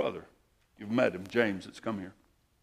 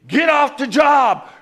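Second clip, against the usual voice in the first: much higher, louder and slow, barking an order; commanding shout; 14 dB louder, slower than usual. Retold or imitated. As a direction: yell him off the site. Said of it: "the guy's commanding me".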